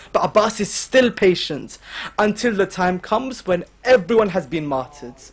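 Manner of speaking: ordinary talk, nothing marked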